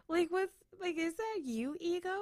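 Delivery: high, amazed asking; startled disbelief. Retold or imitated. Startled by voice in head.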